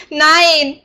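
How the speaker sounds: high, loud, desperate cry of refusal; desperate scream. A narrator's reading. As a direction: scream it out in panic. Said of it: "Schreit die Magd verzweifelt".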